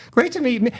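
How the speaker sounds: high-pitched, flustered greeting; stumble, then stretch it out high, overawed; starstruck fumble. Retold or imitated. Acting out his starstruck, fumbled greeting.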